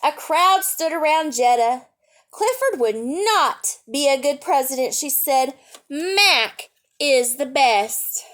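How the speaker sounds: bright read-aloud storytelling voice; lively picture-book reading; story narration. A narrator's reading.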